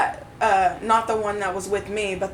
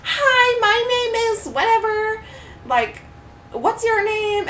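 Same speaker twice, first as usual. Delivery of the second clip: high, sassy mocking voice of the coworker; bratty sing-song put-on; sassy mock imitation; 12 semitones higher, slower than usual. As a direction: mimic her fake-friendly sass, pitched way up. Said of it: "sass with her".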